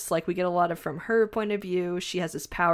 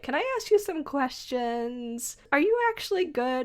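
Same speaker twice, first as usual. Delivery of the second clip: put-on timid child; 9 semitones higher than usual. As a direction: ask it like a wary little girl. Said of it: "Curse is like".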